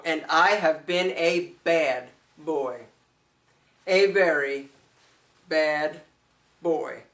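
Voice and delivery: low, slow, drawn-out mock confession; naughty confession. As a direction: pause between the last words, sinking in pitch. Mock confession of cheating on brand.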